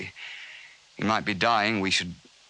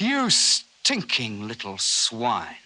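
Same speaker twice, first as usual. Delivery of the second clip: contempt; much slower than usual. Acted. Furious at the careless hunter.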